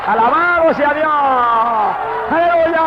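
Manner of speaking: very high, sustained exultant shout; preacher's full-force exclamation; praise shout. Real, not acted. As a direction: cry hallelujah at the climax.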